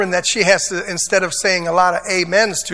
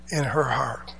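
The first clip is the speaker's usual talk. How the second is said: whisper; 8 semitones lower, 9 dB quieter than usual. Acted. Preacher stresses Michal's hidden contempt.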